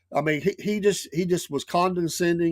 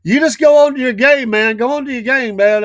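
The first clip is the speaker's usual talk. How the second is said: loud brush-off; 10 semitones higher, 9 dB louder than usual. Retold or imitated. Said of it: "the guy's like".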